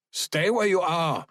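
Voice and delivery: high-pitched sharp order; soldier's warning, pitched high; commanding soldier. A narrator's reading. Soldier orders with rifle raised.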